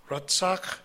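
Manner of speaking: "Ratzak" repeated carefully with ts and final k; measured "Ratzak", both syllables distinct; corrected Hebrew pronunciation. Real, not acted. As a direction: say "Ratzak" clearly as rat-SAK. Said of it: "I'm sorry".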